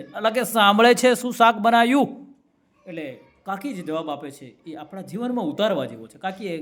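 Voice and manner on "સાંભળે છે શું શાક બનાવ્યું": higher, much louder, slow yell; mock shout straight into the wife's ear; shouted right in her ear. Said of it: "કાનમાં જઈને કહે છે".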